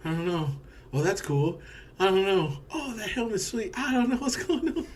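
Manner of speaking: high-pitched, giddy mock bewilderment; squeaky, excited self-imitation; overexcited confusion. Mimics own excited, confused movie reaction.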